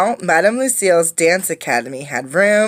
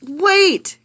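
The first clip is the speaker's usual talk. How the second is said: much higher, urgent call; 12 semitones higher, 3 dB louder than usual. Acted. Girl calling out to stop the dinosaur leaving. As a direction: call her back before she leaves. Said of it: "Clara called out".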